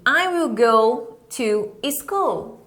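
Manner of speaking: "I will go to school" spoken slowly with a long L on "school"; unhurried teacher model; slow model sentence. Shown as a demonstration. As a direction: model it for students to copy.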